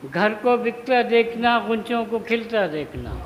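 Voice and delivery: measured mushaira delivery, phrase by phrase; Urdu poetry recitation. Acted. Poet honouring Turfa before the gathering, measured recitation. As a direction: declaim each half-line and let it hang.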